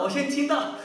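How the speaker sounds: ordinary talk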